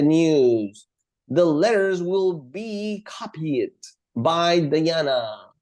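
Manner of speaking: very slow, stretched-out words; slow example reading. Shown as a demonstration. Teacher reading converted sentences to students, slow and drawn-out. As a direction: draw each sentence out for learners.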